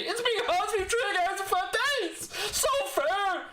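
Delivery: high, laughing, whiny mimicry of a sulking fan; mock the complaint in a squeaky crybaby voice; mocking whiny quote. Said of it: "play the victim card and say".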